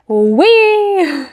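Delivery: high gleeful squeal; excited whee. Real, not acted. Cheering next race.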